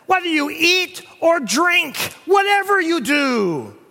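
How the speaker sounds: loud, ringing pulpit delivery; thundering preacher building to a peak; preaching at full force. Acted. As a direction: proclaim it to the whole church. Preacher quoting scripture loudly.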